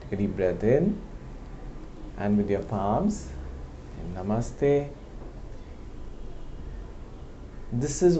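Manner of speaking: unhurried meditation voice with long pauses; calm, softened guiding voice; guided meditation. Real, not acted. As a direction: gently guide listeners inward, leaving space between phrases.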